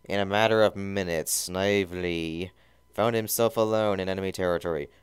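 ordinary delivery

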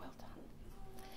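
hushed whispered aside; whispering. Real, not acted. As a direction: whisper it under the ceremony. Officiant's aside during ceremony.

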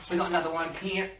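ordinary delivery

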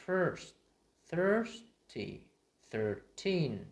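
"first", "thirsty", "thirteen" loud and clearly separated; stressed er examples. Shown as a demonstration. Teacher giving examples, emphatic word demo. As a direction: give "thirsty" a high punched er. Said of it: "Ví dụ như là".

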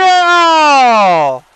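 sharp rising "Oh!"; a sudden shout of shock; loud surprised oh. Real, not acted. Player reacts to chaos.

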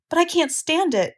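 high-pitched, distressed protest; mild shout. A narrator's reading. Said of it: "shouted Wilbur".

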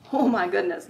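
ordinary delivery

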